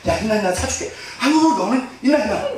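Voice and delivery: mock exasperated parent; impatient parent. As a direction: snap at the kid to get up. Parent scolding crying child.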